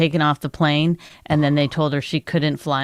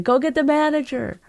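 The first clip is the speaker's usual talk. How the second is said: calling out; 9 semitones higher than usual. Retold or imitated. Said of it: "I was like".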